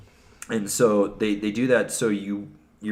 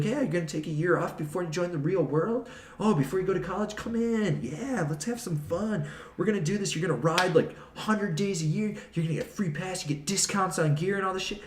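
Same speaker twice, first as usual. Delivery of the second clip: mock recruiter pitch; 9 semitones higher than usual. Mocking Vail recruiters selling the bum life to kids, high sing-song pitch. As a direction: parody a chirpy recruiter luring kids in.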